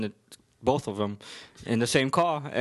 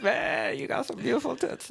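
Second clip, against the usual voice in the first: much higher, laughing through a silly imitation of the cousin; mock cousin voice; 12 semitones higher than usual. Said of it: "He was like".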